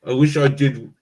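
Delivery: talking with food in mouth; words round a bite of food; mouth full. Real, not acted. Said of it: "Shouldn't talk with something in my mouth".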